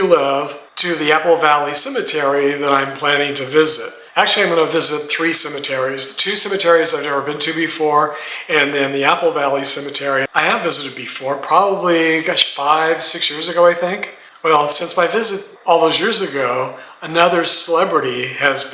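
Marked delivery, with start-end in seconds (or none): none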